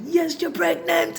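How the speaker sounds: put-on slow, high, loud insistent Jamaican accent. Father insisting to his doubtful daughter, Jamaican accent. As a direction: insist slowly in a Jamaican lilt. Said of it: "He's Jamaican".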